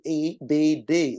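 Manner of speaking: "A, B, D" as long letter names, ay, bee, dee; German-dialect letter names. Shown as a demonstration. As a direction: spell out "A, B, D" one by one. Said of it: "It's a German dialect".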